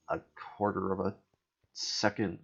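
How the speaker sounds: normal talking voice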